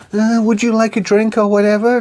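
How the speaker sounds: high-pitched stewardess voice; air stewardess. Retold or imitated. Stewardess offering a passenger a drink, put-on high voice. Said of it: "air stewardess comes over and she's like".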